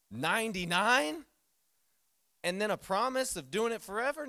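a pastor's astonished question to the congregation; incredulous. Real, not acted. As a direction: stretch it out in amazement. Incredulous at Sarah's age.

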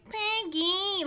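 high whiny nasal call; Nicolas Cage whine. Retold or imitated. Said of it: "he has a really high, nasally, whiny voice".

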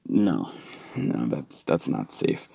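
usual speaking voice

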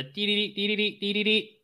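high, even electronic beeps in quick groups; scanner beeping. Acted. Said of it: "you again hear the".

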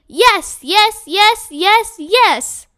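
high, loud, bouncing "yes"; overjoyed, can't hold it in; thrilled yeses. A narrator's reading. Judy answers Mister Todd's offer, loud and overjoyed.

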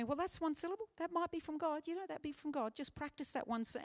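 encouraging mentor. Retold or imitated. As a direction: play the kind mentor urging practice. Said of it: "this person said to me".

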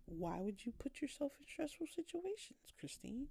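nagging inner critic calling out Christine; scolding myself. Retold or imitated. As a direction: berate yourself like a nagging parent. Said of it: "Beating myself up".

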